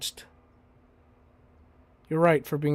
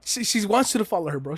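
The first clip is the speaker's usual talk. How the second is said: higher and fast, eager; excited realisation; 5 semitones higher, faster than usual. Real, not acted. Telling chat Fang wants him to follow, fast excited. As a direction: blurt it out with excitement.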